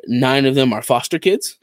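ordinary delivery

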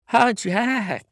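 high-pitched greeting from a caught-out wife. A narrator's reading.

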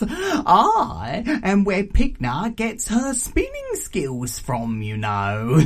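boastful aunt, high start sinking into a chuckle; raucous auntie. Acted. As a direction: brag slyly and laugh.

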